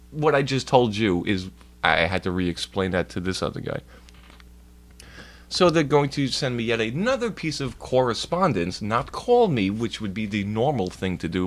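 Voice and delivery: ordinary talk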